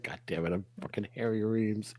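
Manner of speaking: low, soft grumble; muttered aside. Retold or imitated. Pilot grumbling about his career, low muttered aside. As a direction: mutter it to yourself. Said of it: "He's like".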